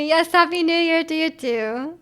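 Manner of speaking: ordinary talk, nothing marked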